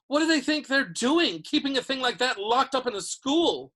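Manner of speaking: high, loud incredulous outrage; indignant. A narrator's reading. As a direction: protest at the sheer madness of it. Ron outraged about the monster dog.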